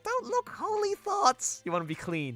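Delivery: goddess voice. Acted. Reading goddess character's comic line.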